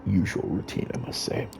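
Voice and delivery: a lightly voiced composed vampire agent; measured report to the prince; low, quiet report. Kadir reports to the court.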